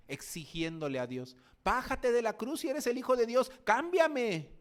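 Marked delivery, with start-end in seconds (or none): 1.6-4.5 high, loud sneering demand from the cross; mocking thief. Wicked thief on the cross taunting Jesus, loud mocking jeer. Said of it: "decía ese malhechor malvado".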